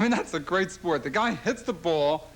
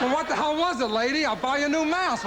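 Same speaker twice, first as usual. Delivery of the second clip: indignant retort; 8 semitones higher than usual. Retold or imitated. Arguing with a woman on street.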